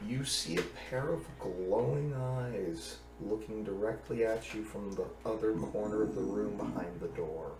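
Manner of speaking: soft, slowing spooky narration; hushed scene setting. A narrator's reading. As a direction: draw them into the dark room quietly.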